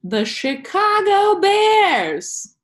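huge drawn-out team introduction; high, loud, very slow PA announcer hype; stadium announcer. Pretend. Imitating stadium announcer for the Bears.

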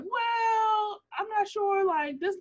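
high-pitched, drawn-out noncommittal quote; hesitant onlooker. Retold or imitated. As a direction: stall in a high, careful little voice. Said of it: "So I just be like".

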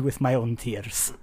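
whispered mock-tragic Russian shopkeeper line; tearful whisper. Deli owner offering a sandwich made with his tears, mock whisper. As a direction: breathe it out like he's about to weep.

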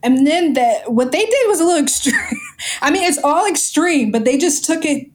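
laughing nervously through the words. Real, not acted. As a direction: giggle uneasily while talking. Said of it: "it's more like a nervous laugh".

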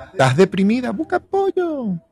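a playful high-pitched busca apoyo; lightly voiced female take, high. Role play advice, female version.

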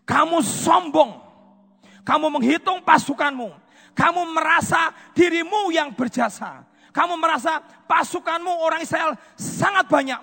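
loud, high accusing rebuke; prophet's rebuke. Acted. Prophet Gad rebuking King David for pride, loud and accusing. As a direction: scold the proud king.

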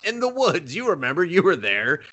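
a hint of a high, mysterious girl voice; mock spooky line. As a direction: tease it like a creepy memory. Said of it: "she doesn't say any of that".

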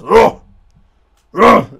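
loud mock monster roar. Friend imitating the lady in bed, loud mock roar.

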